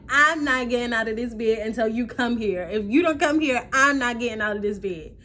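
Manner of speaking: loud, insistent; stubborn refusal. Retold or imitated. Refusing mother over phone.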